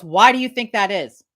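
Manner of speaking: retold doctor's question, pushed out loud; loud, pointed question. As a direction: put the question to them forcefully. Retold doctor's question, first take.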